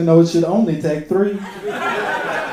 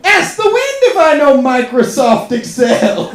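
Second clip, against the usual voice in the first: grand, slow, louder dramatic recital; overwrought poet; 4 semitones higher, 5 dB louder, slower than usual. Retold or imitated. Poet reading his cover letter to employers, grand and slow. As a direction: declaim the cover letter like overblown poetry. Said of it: "I'm just writing cover letters".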